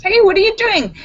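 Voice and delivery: high, loud mock outrage; shocked protest. Californian to Texan who shot the bottle, shocked protest. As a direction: protest in hammed-up disbelief.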